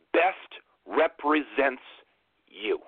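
slow, each word weighted, BEST stressed; slow emphatic repeat. Shown as a demonstration. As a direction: lean hard on "Best represents". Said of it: "I'm gonna say that last part one more time".

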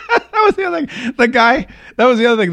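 high, real laughter through the words; laughing. Real, not acted. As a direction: crack up while trying to get it out. Cracks up remembering the call.

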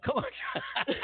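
laughing high and squeaky; cracking up mid-chant. Real, not acted.

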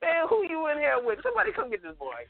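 high-pitched, incredulous comic scolding; mock exasperation. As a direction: playfully call out for someone to fetch the kid. Acts out reply to kid, laughing.

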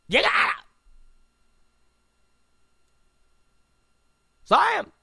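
high shouted plea to vote; mock Obama yelling. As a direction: yell at Sam to go vote. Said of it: "doing his Joe Clark imitation".

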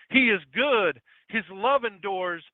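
high, loud proclamation with drawn-out ending. Acted. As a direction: proclaim it slowly, stretching the last word. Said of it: "raised their voices in praise".

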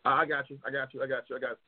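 ordinary talk

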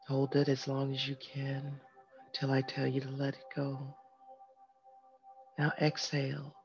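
soft, calm breathing guide; guided meditation. Acted. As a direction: lead them gently through each breath. Leads a breathing exercise.

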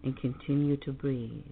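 slow, soothing meditation voice; calm meditative tone. Acted. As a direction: guide them gently into calm. Leads a guided meditation.

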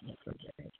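whispered retrograde, voice gone. Shown as a demonstration. Repeating the word, fading out.

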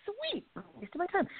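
high, soft and slow mock delight; a sarcastic little cheer, drawn out softly; softly drawn-out mock joy. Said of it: "And I was like".

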